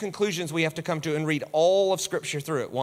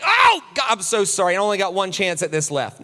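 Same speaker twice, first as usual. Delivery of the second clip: mock guilty disciple; 5 dB louder than usual. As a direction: beg forgiveness in hammed-up panic. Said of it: "They're like".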